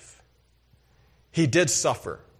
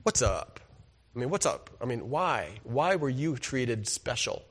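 hushed aside; 3 semitones lower, 6 dB quieter than usual. Retold or imitated. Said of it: "I'm like".

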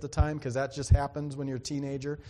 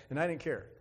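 ordinary talk

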